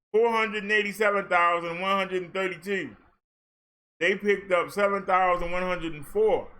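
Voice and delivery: usual speaking voice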